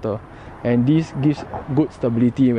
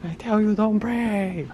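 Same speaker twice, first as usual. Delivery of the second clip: mock Chinese accent; 9 semitones higher, slower than usual. Mimicking the shop guy.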